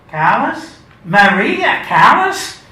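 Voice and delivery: high, loud, slow disbelieving question; dawning disbelief, drawn out; incredulous. Acted. Reading Vera's ticket, incredulous rising question.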